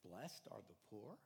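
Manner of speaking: low, soft, deliberately dull reading; flat and unimpressive. Shown as a demonstration. Preacher reading the verse without pizzazz, dull and soft. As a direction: read it with no pizzazz at all. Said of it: "could have had a little more pizzazz".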